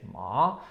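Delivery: MA with a rising tone; syllable melody. Shown as a demonstration. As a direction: let ma climb at the end, like a Chinese tone. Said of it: "depending on the melody".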